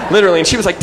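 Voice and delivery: laughing out loud while telling it; bright laughing aside. Real, not acted. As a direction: laugh through the words.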